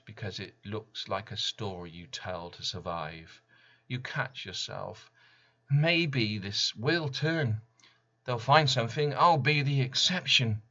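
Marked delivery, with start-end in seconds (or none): 5.7-10.6 desperate hope. Retold or imitated. Inner voice clinging to hope.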